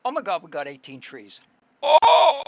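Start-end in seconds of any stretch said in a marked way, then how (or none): 1.8-2.4 sudden high loud gasp; startled exclamation; surprised gasp. Real, not acted. Surprise at the event results.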